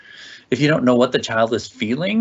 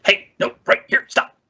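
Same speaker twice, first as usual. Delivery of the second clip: sharp reprimand; 11 semitones higher, 3 dB louder than usual. Shown as a demonstration. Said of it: "not necessarily a sharp, like".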